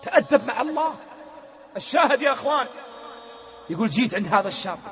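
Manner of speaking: rising, urgent sermon voice; fervent preaching. Acted. The preacher admonishes the young, fervent. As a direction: warn them with fire.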